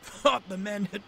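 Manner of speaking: high, strained voice broken by coughs; feeble coughing. Acted. Sick Henry coughing on the turntable, feeble. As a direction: wheeze it out weakly. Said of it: "Henry coughed feebly".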